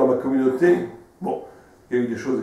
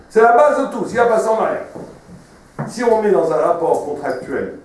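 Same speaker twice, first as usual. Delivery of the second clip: preaching at full force; 7 semitones higher, 8 dB louder than usual. Acted. Preacher stresses marriage's foundation.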